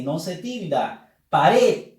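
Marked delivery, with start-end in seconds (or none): 1.3-1.9 "Pared" loud, RED emphasized; teacher demonstrating "Pared" with a strong ending; stress on final RED. Shown as a demonstration. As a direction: punch the last syllable of "Pared". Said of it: "Última sílaba con la fuerza de voz".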